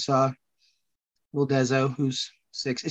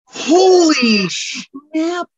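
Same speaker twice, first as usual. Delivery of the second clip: drawn-out, very slow high gasp of shock; stunned disbelief; 12 semitones higher, 4 dB louder, much slower than usual. Real, not acted. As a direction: let it out like you can't believe it. Shocked she never saw it.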